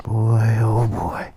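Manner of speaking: usual speaking voice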